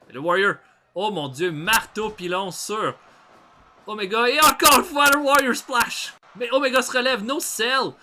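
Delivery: shouting wrestling commentary at full tilt; excited play-by-play. Acted. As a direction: call every move like the crowd's on its feet.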